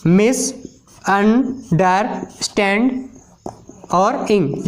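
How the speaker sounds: मिस, अंडरस्टैंड, इंग said as separate stretched-out chunks; syllable split. Shown as a demonstration. Teacher splitting a word into syllables for students. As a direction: break the word into syllables, very slowly. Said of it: "जैसे".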